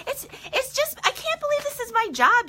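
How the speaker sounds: high squeal of disbelief; delighted gush. Real, not acted. Telling viewers she loves her job, high and gushing.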